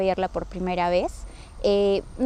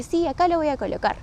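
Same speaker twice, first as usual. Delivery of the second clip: higher-pitched reply, at an unhurried pace; retold assurance; 6 semitones higher than usual. Daughter assures her doubting mother about the posters, mildly insistent. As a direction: answer the doubt with a lifted, sure yes.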